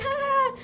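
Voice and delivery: very high, heedless "aah"; playful squeal. Retold or imitated. Comic still splashing after being cursed by friend, playful squeal. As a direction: squeal it, ignoring the warning.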